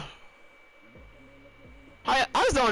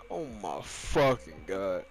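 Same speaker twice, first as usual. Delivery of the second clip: exasperated groan; 9 semitones lower, 6 dB quieter, slower than usual. Real, not acted. Exasperated at own mistake.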